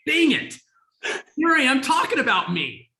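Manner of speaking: loud, high-pitched mock exasperation; exasperated self-reproach. Retelling catching oneself talking about oneself, comically exasperated. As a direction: kick yourself out loud, played up for laughs.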